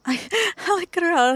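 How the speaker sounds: high, loud laughing words. Real, not acted. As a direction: crack up mid-sentence. Laughing hard at the cry.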